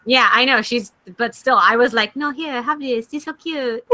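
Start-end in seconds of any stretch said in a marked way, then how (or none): 2.2-3.8 softer, higher sweet mom voice; lightly voiced sugary mother; imitating mom. Friend mimics partner's mom gushing over a kitten, softer and higher. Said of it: "your mother doesn't talk like that".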